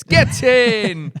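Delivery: loud high cheer; excited cheer. Real, not acted. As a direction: shout it in triumph. Cheering top fifty-five news.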